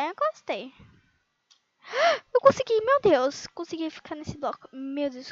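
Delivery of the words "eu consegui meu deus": thrilled gasp. Real, not acted. Gamer girl landing a hard jump, thrilled. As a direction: gasp then a much higher, louder cry of success.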